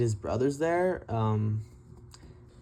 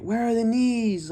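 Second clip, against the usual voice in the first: high, loud yell at the screen; retold scream; 11 semitones higher, 4 dB louder than usual. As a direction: shout it like a frustrated fan. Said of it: "I was screaming the whole fight".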